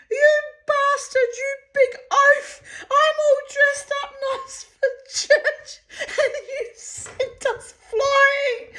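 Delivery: imitating the mother's shrieking rant, laughing; screaming at him. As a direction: rant at the big oaf in mock outrage. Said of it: "she starts screaming at him".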